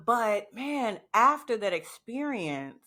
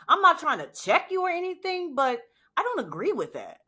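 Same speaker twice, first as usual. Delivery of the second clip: assertive pushback; 9 semitones higher than usual. Retold or imitated. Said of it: "attitude started to not swallow".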